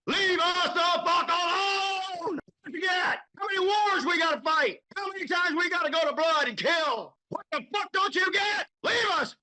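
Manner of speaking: high, strained angry yelling; furious shout. Real, not acted. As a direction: shout them down with rising fury. Furious outburst at the authorities.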